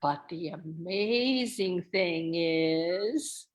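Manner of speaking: slow echo, rising on amazing; echoing the line. Shown as a demonstration. Co-teacher echoing the refrain for children, slow and rising. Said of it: "I'm gonna echo that".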